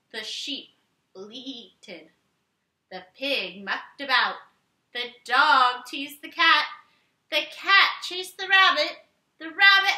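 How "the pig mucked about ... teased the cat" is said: ordinary delivery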